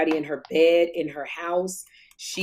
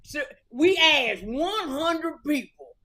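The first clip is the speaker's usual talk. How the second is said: a lightly voiced game show host, slow and a bit higher; Steve Harvey impression; 6 semitones higher, slower than usual. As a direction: drawl it like a mock TV host. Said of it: "Steve Harvey has a country accent".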